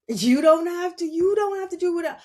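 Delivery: high and loud insistence; emphatic. Real, not acted. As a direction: really drive the point home, up high. Emphatic reassurance to viewers.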